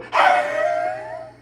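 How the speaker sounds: high, loud, shrieking hyena cackle; crazy hyena laugh. Retold or imitated. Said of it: "that sounded like a crazy hyena".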